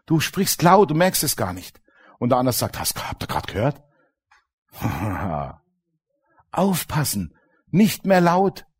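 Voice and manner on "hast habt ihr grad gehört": low, soft gloating devil with a laugh; whisper-gloat to his cronies; low devilish gloat then a snicker; low sneering devil. Retold or imitated.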